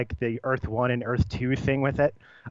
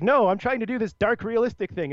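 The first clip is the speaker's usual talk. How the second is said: high, earnest mock protest; lightly hammed-up serious creator; mock filmmaker protest; 8 semitones higher than usual. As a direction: play the offended gritty director, half-joking. Voicing a gritty filmmaker's objection.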